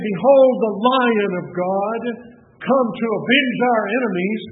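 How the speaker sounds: loud, slow proclamation of "Behold the Lion of God"; a loud herald hailing a conqueror; triumphant proclamation. Pretend. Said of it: "he didn't say".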